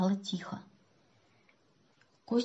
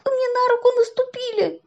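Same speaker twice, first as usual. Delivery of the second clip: put-on high, loud childish wail; pained squeal; 5 dB louder than usual. A narrator's reading. Boy tells man about trodden hand, squealing. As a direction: squeal your complaint.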